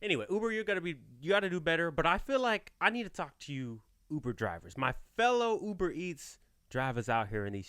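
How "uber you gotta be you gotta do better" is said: ordinary talk, nothing marked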